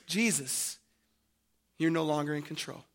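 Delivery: low, soft, quick pronouncement; quiet verdict. Acted. Mockers addressing Jesus on the cross, quietly. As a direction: say it low and hushed. Voicing the mockers' meaning, first take.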